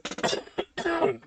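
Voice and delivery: dry, unvoiced-sounding throat cough; real cough mid-talk. Real, not acted. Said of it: "Sorry, I'm gonna cough".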